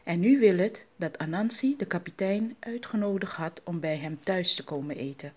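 ordinary delivery

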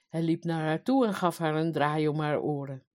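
usual speaking voice

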